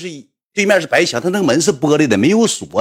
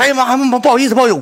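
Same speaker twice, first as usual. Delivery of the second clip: flustered apology; 11 semitones higher, 5 dB louder, faster than usual. Retold or imitated. Caught walking in, apologizing.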